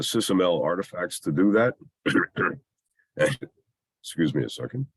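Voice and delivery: ordinary delivery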